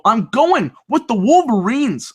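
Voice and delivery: loud, high hyped-up call; excited announcement. Real, not acted. Host revealing an upset bracket pick, excited.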